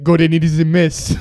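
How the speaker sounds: imitating a French waiter reporting; mock French-accented complaint. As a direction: put on a light French accent. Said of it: "but in a French accent".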